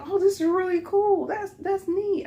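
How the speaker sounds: very high, gushing admiration; mock-delighted squeal of approval; retold impressed manager.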